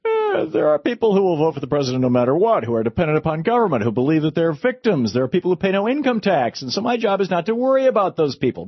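mock a candidate dismissing voters; Mitt Romney impression. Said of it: "Remember when Mitt Romney said that?".

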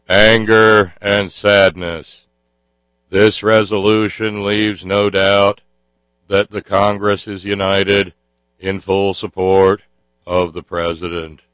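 slow, droning senator monotone; Senator Kerry monotone. Retold or imitated. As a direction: drone it out like a solemn senator. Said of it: "I get to do my Senator Kerry impression".

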